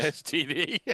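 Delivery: laughing through the words. Real, not acted. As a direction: laugh out the letters. Laughing repeat of joke.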